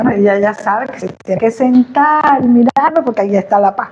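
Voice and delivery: usual speaking voice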